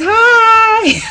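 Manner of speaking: high, loud, drawn-out squeal; relayed cheery greeting. Real, not acted. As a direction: sing out a friend's hello to the viewers. Passes on greeting to viewers.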